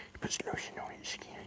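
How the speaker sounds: hushed, teasing whisper about Brandon; whispered aside. Real, not acted. Teasing Brandon to the viewers in a whisper. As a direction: let it slip as a secret whisper.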